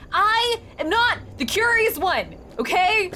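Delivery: high, slow, emphatic protest; indignant. Real, not acted. Player rebuts the creepy stranger, slow and indignant. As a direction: spell out the denial, word by word.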